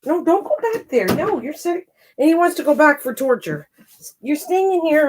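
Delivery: high coaxing words to a cat; high pet voice. Real, not acted. Telling cat to stay.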